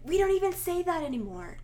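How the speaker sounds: high-pitched retold indignant protest; imitated upset local. Retelling a lady scolding a tourist, mock upset and high. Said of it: "She was so upset".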